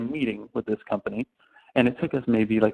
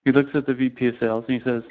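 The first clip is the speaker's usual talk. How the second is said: ordinary delivery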